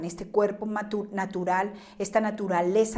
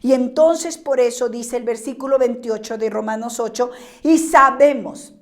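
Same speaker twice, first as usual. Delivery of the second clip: fiery preaching; 3 semitones higher, 7 dB louder than usual. Acted. Pastor rousing the congregation, preaching at full force. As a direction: lift the voice and preach it to the rafters.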